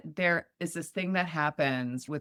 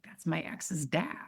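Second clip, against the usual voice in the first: hushed, breathy whisper; secretive whisper about the ex's dad; whispered aside; 5 dB quieter than usual. Retold or imitated. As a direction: lean in and whisper it.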